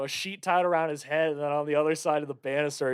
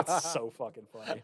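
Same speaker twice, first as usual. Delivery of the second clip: normal talking voice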